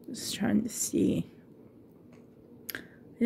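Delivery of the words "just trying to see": soft unvoiced whisper to herself; whispered aside. Real, not acted. Searching the box for a date, whispering to herself. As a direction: breathe it under your breath while looking.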